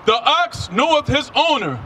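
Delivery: ordinary talk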